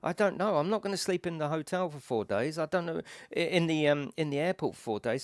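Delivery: high-pitched, flustered back-and-forth; panicking. Retold or imitated. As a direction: fret aloud, acting out helpless panic. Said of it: "I'm really panicking".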